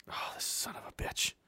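under-breath swearing whisper; whispered curse. Real, not acted. Muttering at webcam.

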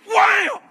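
high, explosive wham; shouted impact noise. Retold or imitated. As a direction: blast out the turbulence jolt. Mimics turbulence hitting again.

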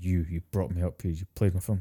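Joker low growl. Retold or imitated. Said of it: "he's get that kind of low growl".

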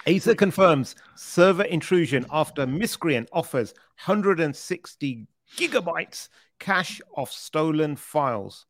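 news headline read out with emphasis. Real, not acted. Host reading a security story to co-host, headline read. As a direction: announce the headline, slowing toward the end.

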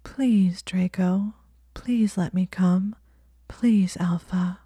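slow breathy coaxing plea; soft cajoling. Acted. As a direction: coax him gently, almost whispering. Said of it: "her voice crept up into a soft, cajoling register".

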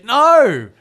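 high, loud retold pleading; comically fed-up parent rant; exasperated dad. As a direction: list the options in loud, high-pitched exasperation. Acted exasperated dad arguing over sandwiches.

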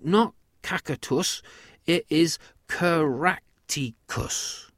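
slow, syllable-by-syllable correction of "Caracticus"; slowly. A narrator's reading. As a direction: spell out the name sound by sound.

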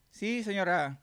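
high obedient servant reply; meek butler answering his mistress. Pretend.

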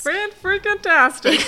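high, loud laughing exclamation; excited. Real, not acted. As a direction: burst it out gleefully. Playful exclamation.

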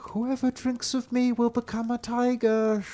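high, otherworldly brook voice; a magic stream speaking high and strange; talking brook. A narrator's reading. Enchanted brook warning the children, in a high eerie voice. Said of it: "how it said as it ran".

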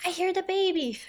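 put-on high, small two-year-old voice; toddler voice. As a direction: pitch it up like a little kid announcing news. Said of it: "he's like".

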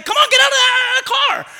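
very high, loud challenging shout; cursing shout. Retold or imitated. Young woman cursing the other driver out, loud shout. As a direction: dare her to step out, yelling. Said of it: "She's cursing out that lady".

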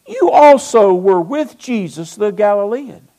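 loud, pointed accusation; acted accusation. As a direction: call him out in front of everyone. Acts servant girl accusing Peter.

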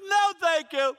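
loud, high-pitched mock outburst; frustrated. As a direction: refuse the snack in acted exasperation. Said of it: "You know, I, I'm frustrated".

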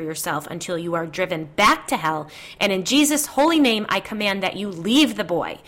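loud, forceful rebuke of a demon; commanding exorcism. A narrator's reading. Psychic commanding demon to leave.

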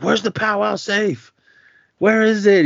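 lightly voiced thug yelling; mock yelling. Thug yelling at beaten manager for the safe. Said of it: "He's yelling at him".

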